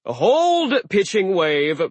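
loud, high stern command; stern sea giant. Acted. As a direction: stop her with a frown. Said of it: "Aegir frowned".

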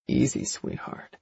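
soft, slow, low reassurance; calm her down gently; soothing. A narrator's reading. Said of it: "attempting to calm me".

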